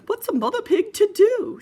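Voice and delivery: flustered mother pig; hammed-up oh dear; high fretting mother pig. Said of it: "said Piggy's mother".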